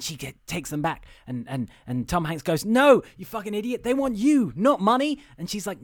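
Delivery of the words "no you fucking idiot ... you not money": high, loud, exasperated retort; mock exasperated scolding. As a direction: scold her, acting it out. Acts out scolding the girl.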